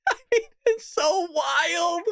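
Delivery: high, squealing laugh through drawn-out words; incredulous laughing. Real, not acted. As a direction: crack up and stretch the words high. Cracks up at the headline.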